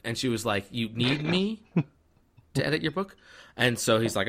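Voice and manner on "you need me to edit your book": high-pitched, rising disbelief; mock incredulous. She answers Jasper's book request, incredulous. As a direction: echo the request back as a disbelieving question.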